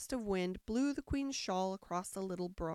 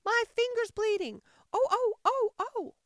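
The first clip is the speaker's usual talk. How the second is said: very high, loud panicked queen; screaming; 13 semitones higher, 9 dB louder than usual. A narrator's reading. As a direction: scream it out like a steam whistle. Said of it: "Her screams were so exactly like the whistle of a steam engine".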